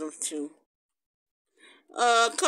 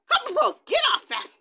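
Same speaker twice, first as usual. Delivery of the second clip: high, quick telling-off; exasperated scolding; 12 semitones higher, faster than usual. Pretend. Shooing the pet off the egg, scolding. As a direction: shoo the pet off, high and fast.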